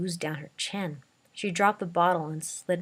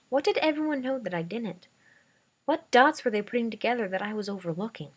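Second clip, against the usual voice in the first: ordinary delivery, nothing marked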